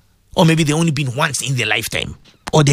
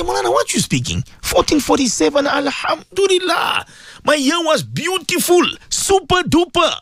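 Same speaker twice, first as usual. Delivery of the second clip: high, bubbly voice of a happy caller; cheerful listener voice; 10 semitones higher than usual. Acted. Host mimics a grateful listener to the Maulana, high and gleeful. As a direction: play the delighted believer, pitched way up.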